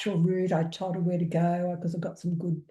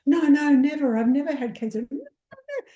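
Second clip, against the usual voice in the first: higher, louder "no, no, never", then a squeaky trailing "no"; client denying; 7 semitones higher, 5 dB louder than usual. Retold or imitated. Client Glad insisting she never had cancer, higher and flustered. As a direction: answer in her flustered high voice. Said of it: "she's probably wondering what I was saying".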